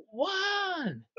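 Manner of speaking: shouting from behind the drums; yelling. Retold or imitated. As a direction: bellow the count at him. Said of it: "he yelled".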